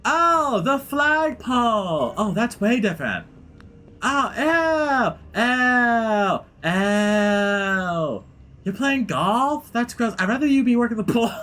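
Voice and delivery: very high, hammed-up disgust; grossed-out daughter. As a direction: squeal in mock revulsion at dad. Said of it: "so grossed out by her dad".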